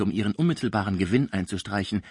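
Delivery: ordinary delivery, nothing marked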